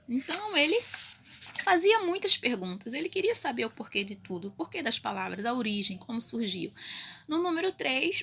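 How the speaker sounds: ordinary talk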